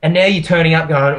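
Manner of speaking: ordinary talk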